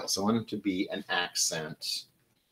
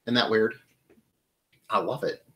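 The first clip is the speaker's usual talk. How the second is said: ordinary talk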